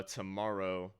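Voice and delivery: ordinary talk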